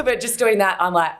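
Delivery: usual speaking voice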